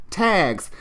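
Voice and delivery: ordinary delivery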